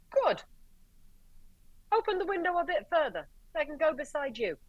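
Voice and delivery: sweet as pie while twisting the knife; high syrupy sarcasm; mock-sweet sarcasm. Sarcastic order to cop.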